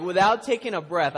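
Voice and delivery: ordinary talk, nothing marked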